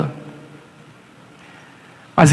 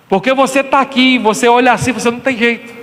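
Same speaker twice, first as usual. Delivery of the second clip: usual speaking voice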